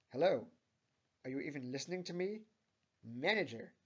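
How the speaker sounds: loud, angry customer demanding attention; angry scream. A narrator's reading. Angry customer demands the manager, screaming out. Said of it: "screams out an angry customer".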